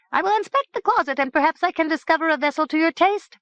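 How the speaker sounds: high, fast servant voice; high and fast, eager to please; hurried servant. Acted. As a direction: rush to please. Nopt eager to help.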